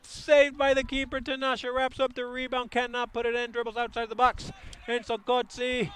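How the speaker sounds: building from fast, loud and high to a full scream on the shot; rising play-by-play. Real, not acted. Live counterattack play-by-play.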